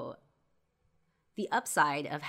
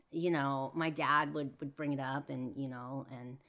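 ordinary talk, nothing marked

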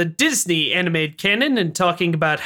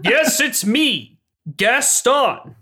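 Gaston; 3 semitones higher, much slower than usual. Acted. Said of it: "supposed to be set in France".

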